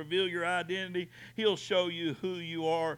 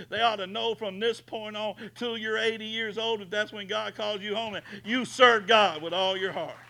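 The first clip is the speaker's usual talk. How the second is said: booming preaching; 6 semitones higher, 8 dB louder than usual. Acted. Preaching at full force.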